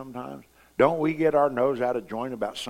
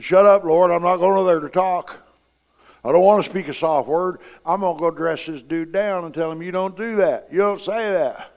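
loud, higher-pitched angry retort; defiant outburst; 6 semitones higher, 9 dB louder than usual. Acted. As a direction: argue back hotly at full voice. Acted angry refusal to the Lord.

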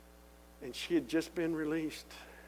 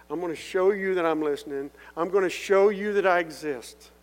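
voice of God; 4 semitones higher, 9 dB louder than usual. Retold or imitated. God answering his prayers, loud solemn voice. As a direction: proclaim it like God speaking. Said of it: "That was God working through that man".

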